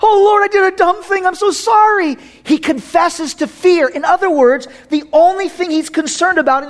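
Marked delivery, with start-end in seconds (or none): 0.0-2.2 higher, pleading, mock-contrite repentance; pleading apology. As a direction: beg forgiveness in a hammed-up whine. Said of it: "He doesn't say".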